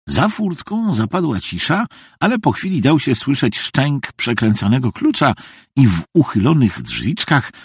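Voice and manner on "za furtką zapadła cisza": ordinary delivery, nothing marked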